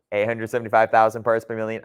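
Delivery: fast auctioneer rattle; auctioneer patter. Real, not acted. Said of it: "I sound like an auctioneer".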